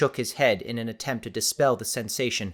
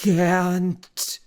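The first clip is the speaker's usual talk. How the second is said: forced out through a shaking jaw; quivering jaw; 7 semitones higher, 5 dB louder than usual. A narrator's reading. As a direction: strain the word out, trembling. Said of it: "He barely managed through his quivering jaw".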